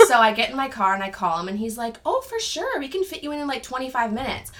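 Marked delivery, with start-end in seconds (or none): 2.1-4.5 high, airy mock-agreeable voice; breezy stylist. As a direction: imitate an easygoing guy saying no problem, pitched way up. Acts breezy stylist on phone.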